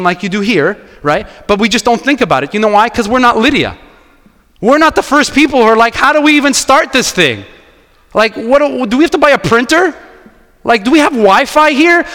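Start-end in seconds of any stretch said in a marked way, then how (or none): 4.6-12.1 flustered questions. Retold or imitated. First church planters' bewildered questions.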